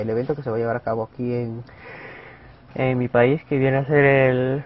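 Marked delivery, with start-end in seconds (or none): 2.7-4.6 words stretched by a yawn. Real, not acted. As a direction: talk through a big yawn. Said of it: "perdón por el bostezo".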